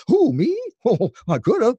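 high cartoon owl asking who me. Voice actor as the owl, high cartoon voice. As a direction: keep the owl's hoot in it. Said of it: "all we owls they ever say is".

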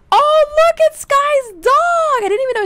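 high, loud squeal of delight; excited squeal. Real, not acted. Player spotting Sky's dog for the first time, excited.

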